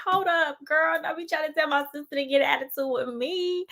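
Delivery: high-pitched mock telling-off; sassy, high-pitched warning; retold indignation. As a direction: put the meddling friend in check, voice way up. Retells confronting sister's friend.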